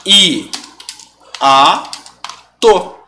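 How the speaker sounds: I, a, to said as separate syllables with pauses; teacher splitting the word into syllables; syllables split apart. Shown as a demonstration. Teacher shows students hiato split into I, a, to. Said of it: "Vamos separar as sílabas de hiato".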